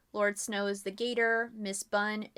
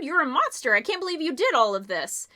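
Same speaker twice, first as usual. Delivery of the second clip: loud, higher, furious blame; angry accusation; 5 semitones higher, 7 dB louder than usual. Acted. The three accusing Mister James, loud and angry. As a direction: hurl the accusation at him.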